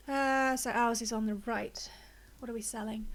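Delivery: normal talking voice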